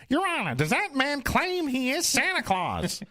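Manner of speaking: very high, slow put-on lawyer voice; Don Adams voice. Retelling his play role, a high slow voice. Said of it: "that other guy's voice is supposed to sound like Don Adams".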